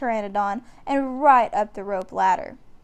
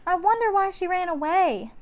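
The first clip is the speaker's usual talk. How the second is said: put-on much higher girlish wondering; young girl puzzled; little girl; 9 semitones higher than usual. A narrator's reading. Annie wondering to Jack, high girlish voice. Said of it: "said Annie".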